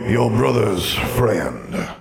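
very slow, with weight on friend; slowly. Acted. Ghost answering the girl, slow and pointed. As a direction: drag it out, pointed. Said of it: "Absalom said slowly".

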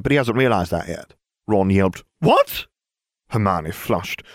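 a hint of a brisk presenter; lightly voiced Ginevra briefing. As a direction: lay out the gossip like a report. Ginevra presenting relationship web.

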